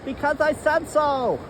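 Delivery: high, bossy inner voice; scolding inner voice. Pretend. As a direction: scold yourself from the outside. Inner voice answers back.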